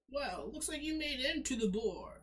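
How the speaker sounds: loud, high-pitched, hammed-up Southern accent; horrible Southern accent. Game master voices old man greeting player, exaggerated Southern accent. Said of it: "puts on the southern accent".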